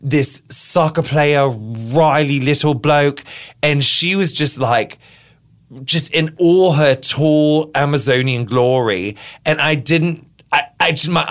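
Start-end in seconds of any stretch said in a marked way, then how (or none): 0.0-3.2 slow put-on British accent; a slow, drawn-out English bloke voice; a light British accent, savoured slowly. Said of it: "Wasn't my accent, like, glorious". 5.8-9.1 slow put-on British accent; a slow, drawn-out English bloke voice; a light British accent, savoured slowly. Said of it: "Wasn't my accent, like, glorious".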